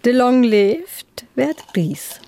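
"De long lebt, wird gries" langsam mit gerolltem R in "gries"; rollendes R. Shown as a demonstration. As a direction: das R in "gries" kräftig rollen, plattdeutsch gedehnt. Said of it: "ich hab's so ein bisschen mit rollendem R versucht". ("gries" mit rollendem Zungen-R, langsam und etwas höher)

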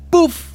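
sharp high burst on "Puff"; mock explosion "puff". Dealer sketching the drug run, a loud "Puff". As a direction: pop the word like a blast.

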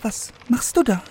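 put-on high, curious young princess voice; sweet fairy tale princess. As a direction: ask sweetly like an innocent princess. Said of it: "sprach die Königstochter".

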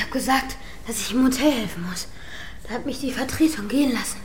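hushed whisper; a guilty little whisper. Real, not acted. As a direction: whisper it so nobody overhears. Admitting a little lie.

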